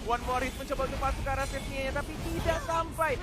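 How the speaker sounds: high, loud excited play-by-play; fighting game announcer. Acted. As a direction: build the call to a peak. Caster calls a teamfight.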